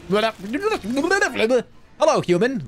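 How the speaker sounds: high, strange alien greeting. Pretend. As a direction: play a stiff alien. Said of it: "where the aliens go".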